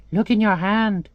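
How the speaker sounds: high-pitched talking bird. Acted. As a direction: tell him in a little bird's high voice. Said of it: "the bird tells him".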